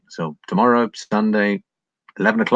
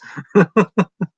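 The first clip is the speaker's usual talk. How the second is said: loud, high burst of laughter; 12 semitones higher, 6 dB louder than usual. Real, not acted. Streamer cracking up at his own joke on chat. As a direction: break into laughter.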